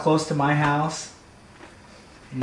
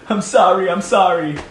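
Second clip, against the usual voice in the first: pleading apology; 5 semitones higher, 5 dB louder than usual. Acted. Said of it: "the new cat would have no fur, naked, going".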